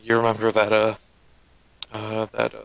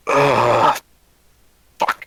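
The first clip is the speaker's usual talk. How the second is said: groggy groan; 7 dB louder than usual. Acted. Character wakes up groaning.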